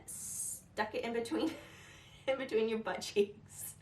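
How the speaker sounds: breaking into a laugh mid-phrase; trying not to laugh while explaining; real stifled laughter. Real, not acted. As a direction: giggle escaping through the words. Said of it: "I'm sorry, I shouldn't laugh".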